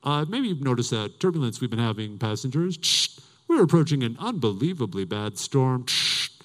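low, deadpan pilot with mouth-made static bursts; airline captain announcement. Retold or imitated. Captain to passengers in turbulence, mock-calm announcement. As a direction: imitate a cockpit announcement, crackling between phrases. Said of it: "The captain's like".

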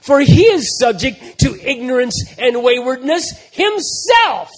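loud, rising preached reading; ringing pulpit proclamation; full-force scripture reading. Acted. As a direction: preach the passage over the music. Reads Hebrews with full force.

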